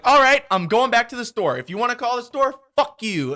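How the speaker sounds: loud, high, storming-off anger; acted fed-up outburst; imagined defiant retort. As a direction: shout it as a parting shot. Imagined angry retort to customer.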